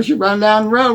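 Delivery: ordinary talk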